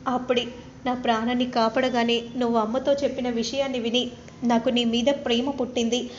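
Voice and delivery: ordinary delivery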